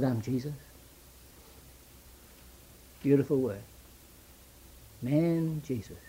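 soft, tender hush on Jesus. Acted. Preacher savouring the name Jesus for the congregation, tender hush. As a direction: let the name Jesus fall gently.

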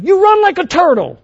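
hammed-up angry sports dad; mock shouting dad. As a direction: yell it from the stands like an obnoxious father. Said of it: "the dad would shout".

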